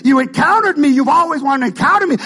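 loud, high shouted preaching; preaching at full force. Acted. Pastor prophesying to the congregation, full-force shout. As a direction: build it to a roaring prophetic cry.

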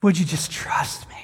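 gently pleading Spirit. Acted. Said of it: "And the Spirit's like".

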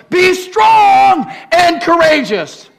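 high, slow, full shout; shouted command. Acted. Preacher shouting God's charge at the room. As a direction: bellow each word.

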